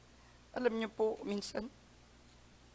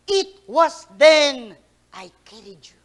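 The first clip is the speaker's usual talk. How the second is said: the Lord's reply; 2 semitones higher, 12 dB louder, slower than usual. Acted. The Lord answering in footprints story.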